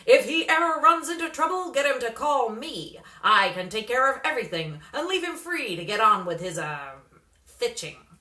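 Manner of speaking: put-on booming, pushy sales pitch to a kid; loud brash manager. A narrator's reading. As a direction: hustler loudly promising to handle everything. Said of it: "said the man".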